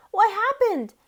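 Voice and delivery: high, loud and startled; surprised. A narrator's reading. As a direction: cry out in shock.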